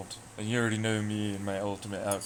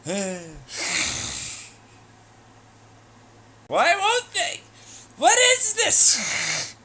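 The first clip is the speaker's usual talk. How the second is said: much higher, louder squeal, very drawn out; high, loud, slow squeaky outrage; squeaky cartoon shriek; 12 semitones higher, 10 dB louder, much slower than usual. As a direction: shriek it like a tiny cartoon critter. Squeaky character outburst.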